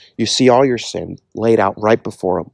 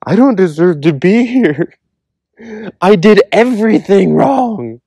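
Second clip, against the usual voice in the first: much higher, humbled voice of the repentant; humble confession; 9 semitones higher, 4 dB louder than usual. Retold or imitated. People in heaven confessing their unworthiness, humbly and high. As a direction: confess it small, lifting the pitch high and slowing the last line. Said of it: "are saying".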